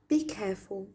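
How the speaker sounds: ordinary talk, nothing marked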